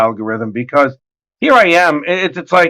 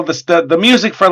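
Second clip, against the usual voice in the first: ordinary delivery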